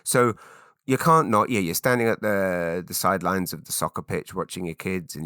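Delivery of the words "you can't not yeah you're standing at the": ordinary talk, nothing marked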